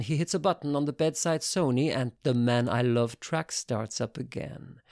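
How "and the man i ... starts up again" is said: plain reading voice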